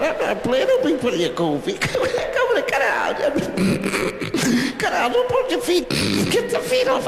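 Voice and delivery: high, giggly squeals of play; laughing through the words while tussling; giggling. Acted. Boys giggle fighting over cold feet.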